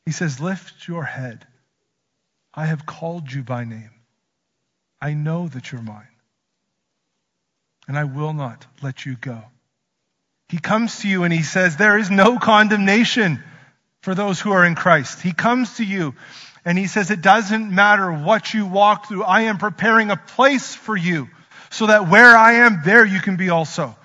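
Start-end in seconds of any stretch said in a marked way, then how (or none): 0.0-9.4 a hint of God speaking tenderly; tender promise. Voicing God's promises tenderly. 11.8-24.0 louder, higher preaching; preacher proclaiming God's promise with fervor; rousing proclamation. Acted.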